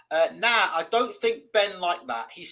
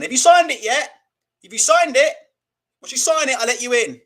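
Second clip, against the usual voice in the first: loud, higher pushy dad voice; demanding father; 4 semitones higher, 8 dB louder than usual. Retold or imitated. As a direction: press him hard through the window. Father voiced through window.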